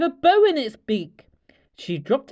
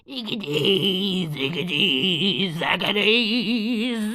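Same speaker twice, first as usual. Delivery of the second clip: slow, chanted witch's spell; muttered spell; much slower than usual. Acted. Witch stirring her cauldron, muttering a spell. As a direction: mutter it like a magic incantation. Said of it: "she muttered a spell".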